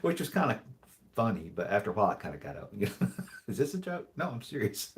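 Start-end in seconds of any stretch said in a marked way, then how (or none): 3.5-4.9 quoted exchange, higher voice; imitating wife then himself. Husband quoting wife's puzzled question, then his reply. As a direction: mimic a puzzled wife asking, then a flat serious reply. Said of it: "Whenever I would say".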